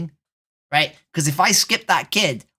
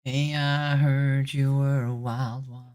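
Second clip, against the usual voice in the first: mock flirt; 3 semitones lower, 5 dB quieter, slower than usual. Cheesy pickup line to stranger.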